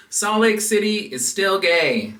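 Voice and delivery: loud, high slogan call; announcer shout. Shown as a demonstration. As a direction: call it out like a rally chant. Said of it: "I had to practice it a few times".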